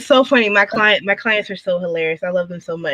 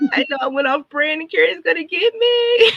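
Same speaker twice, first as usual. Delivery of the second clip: higher, whimpering mock dread; mock fearful whimper; 6 semitones higher than usual. Client admitting off-brand design, in a mock frightened whimper. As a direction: play the client scared of getting caught.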